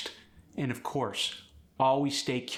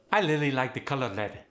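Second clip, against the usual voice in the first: Khmer accent, R turned to L; Khmer-accented "really" as lily, "red" as led; faster than usual. Shown as a demonstration. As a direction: soften every R to L, Khmer style. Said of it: "The R was softened into an L".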